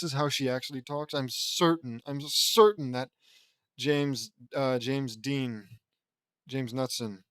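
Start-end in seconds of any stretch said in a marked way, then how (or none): none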